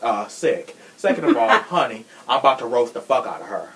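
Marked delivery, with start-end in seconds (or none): 1.0-1.5 emphatic higher restart. Real, not acted. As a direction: jump up high and louder on Second of all. Restarting her point, emphatic.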